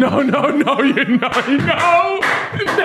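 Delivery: high squealing disbelief; shocked denial. Real, not acted. Hearing a shocking bedroom line, high squeals of no.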